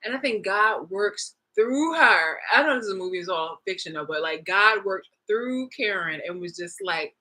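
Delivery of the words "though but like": normal talking voice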